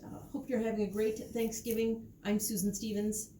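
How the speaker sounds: ordinary delivery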